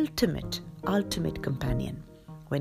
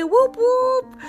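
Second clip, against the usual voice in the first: high, loud joyful cheer; excited whoop; 11 dB louder than usual. Real, not acted. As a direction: cheer it out, thrilled. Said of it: "that was really me getting very, very excited".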